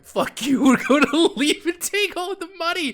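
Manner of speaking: high, loud jeering voice; hammed-up high-pitched taunt; mock betrayers. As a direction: shout it as gleeful mock traitors. Voices friends turning on the player.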